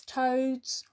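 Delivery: ordinary delivery, nothing marked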